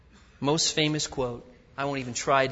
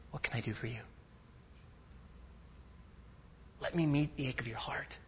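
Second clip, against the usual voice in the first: tender God voice; 12 dB quieter, faster than usual. Retold or imitated. God speaking to needy people.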